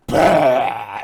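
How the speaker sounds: sudden loud "bah" burst; breath weapon blast. Acted. Imitating dragon breath weapon.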